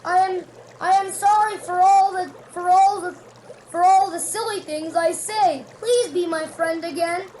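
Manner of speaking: high, loud begging apology; pleading. A narrator's reading. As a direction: beg a friend's forgiveness. Toad begs forgiveness.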